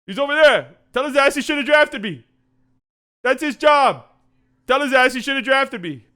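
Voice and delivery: hammed-up Luka taunt, high and loud; mock trash talk. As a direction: sneer it at the guy who didn't draft you. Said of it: "it's like a different beast".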